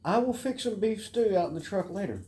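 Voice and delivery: high, sing-song voice for the dog; lifted doggy talk; high pet talk. Real, not acted. Cooing to his dog Loki.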